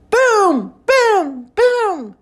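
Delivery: high, ringing, falling booms; high exclaimed thunder imitation. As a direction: boom like thunder, each one drawn out. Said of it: "Mister Brown makes thunder".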